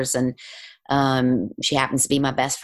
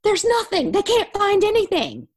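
much higher, thrilled announcement; excited news; 3 dB louder than usual. Retold or imitated. Client telling the healer her scans are clear, thrilled and much higher. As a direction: deliver the all-clear with joy, very high. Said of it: "she was so excited".

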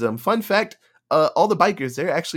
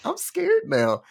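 mock scared; 14 semitones higher, slower than usual. Imitating a scared survivor.

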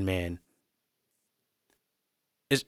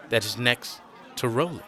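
ordinary delivery, nothing marked

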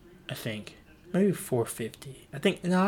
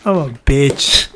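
loud confession; 12 dB louder, slower than usual. Real, not acted. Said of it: "I just accept it".

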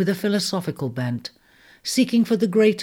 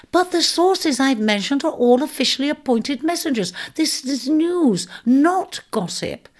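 high mouse voice, slowing firmly on the last words; an offended little mouse standing its ground; insistent mouse; 9 semitones higher than usual. Acted.

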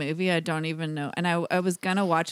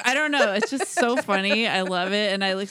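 normal talking voice

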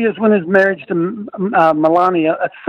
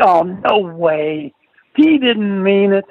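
slow, drawn-out scoffing imitation; mock disbelief; much slower than usual. Host mimics doubters of Trump's conversion, drawn-out disbelief. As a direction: stretch each word like a scornful doubter.

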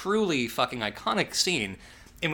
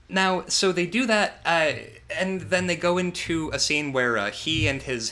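ordinary delivery

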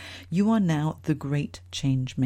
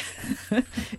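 breathy laugh; 8 semitones higher, 3 dB quieter than usual. Real, not acted. Laughing along with the story.

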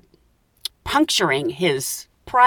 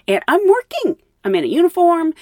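exasperated emphasis; 9 semitones higher, 4 dB louder than usual. Real, not acted. Exasperated at being stuck working.

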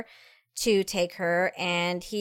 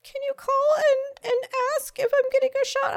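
put-on scared child voice. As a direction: plead like a frightened little boy. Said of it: "gets shaky voice".

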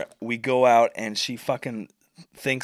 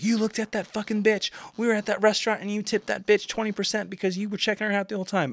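high, rushed jealous rant; imitated screaming accusation; 10 semitones higher, faster than usual. Girlfriend accusing him after the restaurant, screaming in retold fury. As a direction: mimic an angry girlfriend's high-pitched tirade. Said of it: "where she's screaming".

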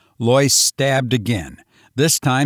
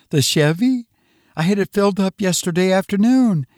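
high-pitched, puzzled woman's voice; dazed wife; 8 semitones higher, faster than usual. Acted. Dazed wife answers husband about the car, high and puzzled.

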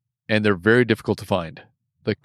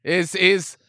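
put-on failed Boston accent; 6 semitones higher, much slower than usual. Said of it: "I can't do a Boston accent".

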